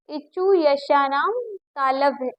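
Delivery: इचु यशानाम तालव्य recited slowly, syllable by syllable; slow recitation. Shown as a demonstration. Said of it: "क्या आता है?". (धीरे-धीरे सूत्र का पाठ)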